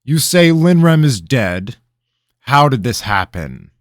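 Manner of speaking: high, loud sharp question; a lightly voiced alarmed king; startled king. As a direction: demand the story at once.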